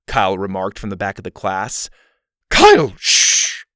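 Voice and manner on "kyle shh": high, loud drawn-out shushing; teacher's sharp reprimand; scolding. A narrator's reading. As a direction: scold and shush a pupil loudly. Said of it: "Missus Arthur scolded".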